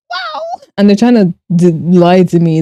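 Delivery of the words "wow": very high, very soft wow; stunned wow. Real, not acted. Streamer stunned by the church's teaching, a breathy high wow. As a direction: breathe it out in disbelief.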